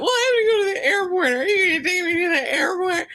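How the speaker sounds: high, fast crying whine; mock sobbing. Host imitating Elise begging for an airport ride, high mock crying. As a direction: sob the question out. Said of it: "Elise still crying".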